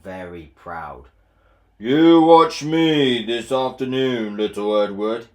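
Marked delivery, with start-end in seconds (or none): 1.8-5.3 boastful Gordon. Acted. Proud engine boasting to little Edward. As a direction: puff up proud and loud. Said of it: "he boasted".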